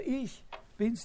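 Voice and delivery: single out "ich" with a high accent; heavy emphasis on "ich"; stress on ICH. Shown as a demonstration. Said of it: "Betonung auf dem Ich".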